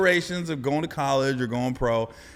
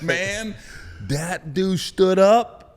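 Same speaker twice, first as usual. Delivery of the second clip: usual speaking voice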